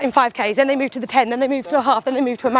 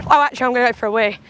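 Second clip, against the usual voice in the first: usual speaking voice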